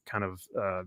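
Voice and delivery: ordinary delivery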